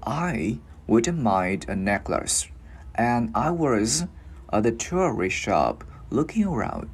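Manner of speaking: very slow, careful model reading; walk learners through it word by word, slowly; slow, step by step. Shown as a demonstration. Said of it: "mình cứ đi từ từ vào". (đọc chậm rãi, từ từ từng cụm)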